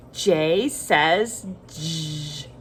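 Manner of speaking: slow J by name, then j as a long buzzed "jzhh"; letter then sound. Shown as a demonstration. Teacher leading children to repeat, slow J says j with buzzed sound. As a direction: stretch it out: "jay says jzhh" for J says j. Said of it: "Say it with me".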